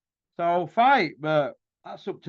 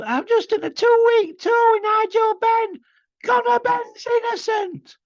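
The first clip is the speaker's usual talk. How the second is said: high, mocking impression of a whiny boxer; mocking Ricky Hatton; 6 dB louder than usual. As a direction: squeak it out like a whining mate. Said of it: "giving it the old".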